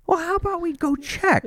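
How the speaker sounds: very high-pitched mock exasperation; exasperated suggestion. To the screaming driver about his hurt wife, exasperated. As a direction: push it up high, as if stating the obvious.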